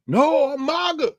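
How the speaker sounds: high, slow, drawn-out Southern retort; loud, hammed-up Southern whine; Byron Donald, Southern accent. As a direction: protest in an exaggerated Southern accent. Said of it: "I shouldn't do a Southern accent for Byron Donald's".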